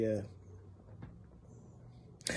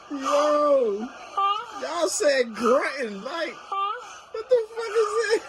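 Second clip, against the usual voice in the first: high and slow, laughing through words; crying with laughter; 13 semitones higher, 4 dB quieter, slower than usual. Real, not acted. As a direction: wheeze the words out between laughs.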